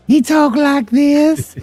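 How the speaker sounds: mock creepy voice. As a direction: drag it out, a hint of a creepy man. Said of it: "he was more like this".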